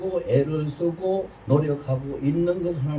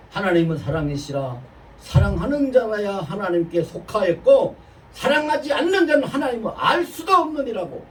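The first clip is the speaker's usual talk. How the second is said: scripture recitation; 5 semitones higher, 5 dB louder than usual. Acted.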